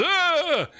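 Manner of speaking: high startled crowd cry "ah"; a sudden high cry of awe; crowd gasp. Retold or imitated. The temple crowd cries out as the place shakes, high gasp. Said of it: "everyone goes".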